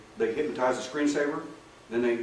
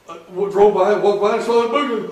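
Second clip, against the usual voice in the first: much higher and louder, rushed and jittery; frightened retelling; 8 semitones higher, 12 dB louder than usual. Retold or imitated. Acting out a scared client passing the book, a mock panicky voice. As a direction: tell it as if the fright is coming back.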